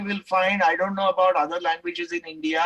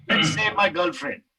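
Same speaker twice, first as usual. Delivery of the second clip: ordinary talk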